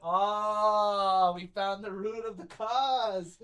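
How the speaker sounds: loud, long drawn-out dawning realization; hammed-up "now I get it"; mock realization. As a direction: stretch it out as a big reveal. Mock realization in retold scene.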